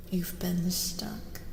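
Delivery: usual speaking voice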